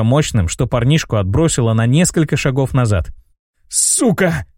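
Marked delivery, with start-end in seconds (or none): 3.7-4.5 strained gasp. A narrator's reading. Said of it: "едва смог выдавить тот".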